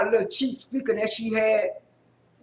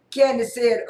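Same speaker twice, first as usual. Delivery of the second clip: ordinary talk, nothing marked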